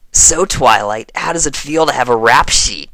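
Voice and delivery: loud playful teasing. A narrator's reading. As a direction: needle her with a grin. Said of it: "Rainbow teased".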